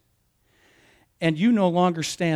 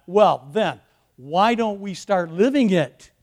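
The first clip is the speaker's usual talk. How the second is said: normal talking voice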